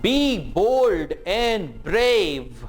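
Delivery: Be bold and brave drawn out very slowly; very slow teacher demo; very slow model. Shown as a demonstration. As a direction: stretch it for learners. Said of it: "इन sentences को मेरे साथ-साथ repeat करें".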